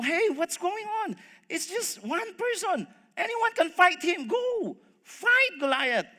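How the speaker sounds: high, urgent rallying cry; cheering them on. Acted. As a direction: egg the troops on, pitch way up. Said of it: "He's probably cheering them".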